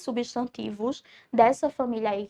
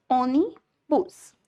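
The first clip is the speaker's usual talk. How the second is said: "onibus" slowly broken into "oni" and "bus"; "onibus" drawn out, chunk by chunk; split into syllables; much slower than usual. Shown as a demonstration. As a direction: say "onibus" in slow separate chunks, pause before "bus". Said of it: "ônibus tem duas sílabas".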